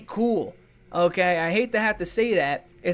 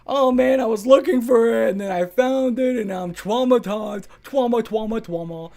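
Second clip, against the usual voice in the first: mock traumatized viewer; 3 semitones higher, 5 dB louder than usual. Mocking people who searched it.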